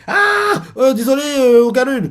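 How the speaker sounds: «ah» lancé très haut puis excuse gênée; excuse gênée très aiguë. Retold or imitated. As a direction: se confondre en excuses, paniqué. Excuse gênée, cri aigu. (excuse gênée et paniquée, voix très aiguë)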